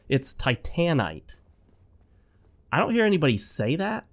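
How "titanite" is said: "Titanite" said tie-TAN-ite, second syllable stressed; slow "Titanite" with a full TAN in the middle; proposed pronunciation. Shown as a demonstration. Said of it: "I do actually think that the proper pronunciation".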